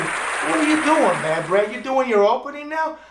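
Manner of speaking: lightly voiced viewer asking why the host opens the show; imitating a puzzled viewer. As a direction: play a baffled fan calling him out. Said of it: "Now you're saying".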